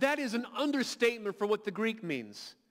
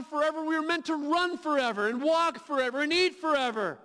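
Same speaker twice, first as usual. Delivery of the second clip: louder and higher, declaiming in full force; preaching climax; 5 semitones higher, 3 dB louder than usual. Acted. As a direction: drive each line harder, like a rallying cry.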